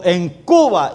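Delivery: loud shocked shout; officer shouting at the pastor, loud and shocked. Retold or imitated. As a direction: bark it out in disbelief. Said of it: "El hombre gritó".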